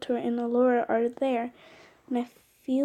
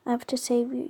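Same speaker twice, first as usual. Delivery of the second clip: ordinary delivery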